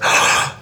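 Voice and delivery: sharp audible intake of breath; imitated gasp of amazement; mock shocked gasp. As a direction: gasp like an astonished granddaughter. Granddaughter amazed as grandmother moves.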